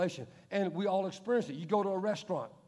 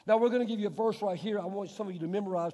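ordinary delivery

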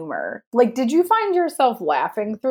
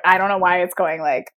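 ordinary talk, nothing marked

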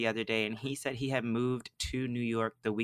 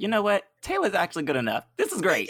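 higher and louder, upbeat fake announcement; mock casting call; 8 semitones higher, 6 dB louder, faster than usual. Casting team calling back to say she booked it, mock excited. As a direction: play the casting team cheerfully changing their minds.

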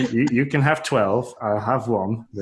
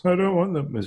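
put-on high whining toddler refusal; whiny little boy; 8 semitones higher than usual. Dad voicing his son refusing vegetables, high and whiny. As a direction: pout it out like a kid refusing greens.

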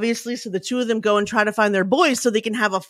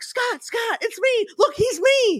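urgent plea; slower than usual. Retold or imitated. Pleading with ranting boss, retold.

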